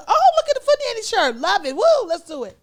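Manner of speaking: high, loud, rapid excited gushing; excited retelling. Retold or imitated. Acting out Oprah's excited tweet about the shirt, gushing. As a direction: gush over it at a high pitch.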